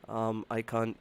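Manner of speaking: ordinary delivery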